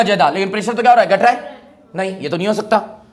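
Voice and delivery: ordinary talk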